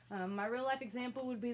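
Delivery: ordinary talk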